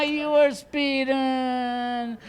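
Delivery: slow sorry cop voice; mopey policeman; apologetic cop. Retold or imitated. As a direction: sound a little guilty about the ticket.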